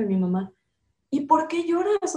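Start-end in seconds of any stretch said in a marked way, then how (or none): 1.1-2.2 high, irritated mother's question; annoyed. Retold or imitated.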